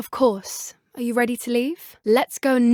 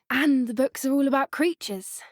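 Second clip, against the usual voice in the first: usual speaking voice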